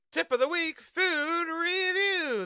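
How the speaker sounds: high, slow sing-song reviewer intro; mock intro. Streamer imitating Review Bra's intro. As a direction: drag out food review like the YouTuber.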